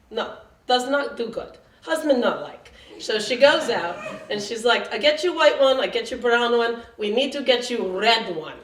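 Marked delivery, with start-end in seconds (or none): 0.1-2.7 higher-pitched Russian saleslady; bad Russian accent. Bra fitter rejecting a bra, bad Russian accent. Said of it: "I don't do a very good Russian accent". 4.9-8.6 Russian saleslady listing the options; bad Russian accent. Bra fitter promising more bras, bad Russian accent. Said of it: "I don't do a very good Russian accent".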